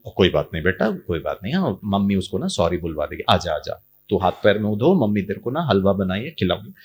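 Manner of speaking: low, soft coaxing; soothing mother. Retold or imitated. Mother calming her crying son, low and soft. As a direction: hush the child gently.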